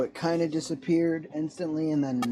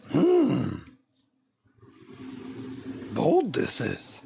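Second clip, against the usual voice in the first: put-on slow, inverted wise old Yoda croak; much slower than usual. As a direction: ponder it like Yoda. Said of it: "the Yoda of coffee".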